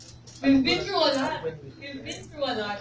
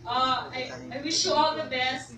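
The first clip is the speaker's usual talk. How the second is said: ordinary talk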